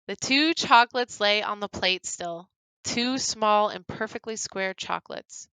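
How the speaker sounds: usual speaking voice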